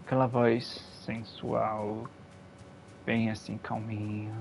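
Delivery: low, slow seductive radio voice; sensual announcer voice. Pretend. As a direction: purr it like a late-night announcer. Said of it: "com voz de locutor pra você".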